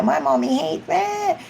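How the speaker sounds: put-on high little kid; childish complaint. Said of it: "the kids will think".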